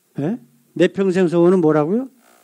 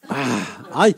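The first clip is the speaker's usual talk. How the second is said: ordinary talk, nothing marked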